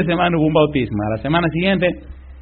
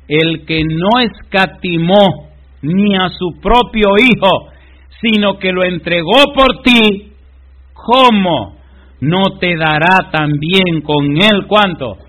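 slow, higher, louder declamation; proclaiming scripture; 5 semitones higher, 6 dB louder, slower than usual. Acted. Preacher reading Romans to the congregation, slow and loud. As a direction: ring out every word of the verse.

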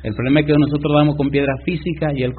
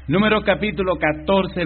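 reading the chapter aloud; announcing the reference; 6 semitones higher than usual. Shown as a demonstration. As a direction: call out "Números" clearly. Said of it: "repeated reference".

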